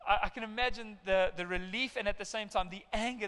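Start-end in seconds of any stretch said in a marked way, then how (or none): none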